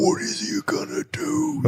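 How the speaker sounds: very high, thin whispery squeal; soft, strained high whine, drawn out slowly; put-on vape-hit voice. Joking that vaping changed how he talks, put-on high squeaky voice. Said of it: "that's how I talked".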